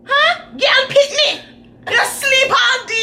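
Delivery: high, far-off shouting mother voice; imitating angry mama. Mother bursting in on her sleeping kid, high shrill yelling. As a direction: yell like a mom kicking open the door. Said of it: "my mama bust open the door. With a kick".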